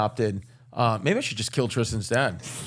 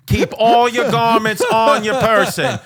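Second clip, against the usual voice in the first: mock TSA agent; 7 semitones higher, 4 dB louder than usual. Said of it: "T S A's like".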